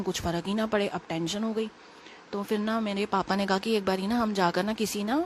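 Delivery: normal talking voice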